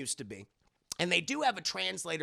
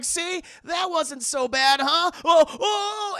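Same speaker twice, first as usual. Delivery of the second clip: mock Indy panic; 12 semitones higher, 10 dB louder, slower than usual. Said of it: "Indiana Jones is like".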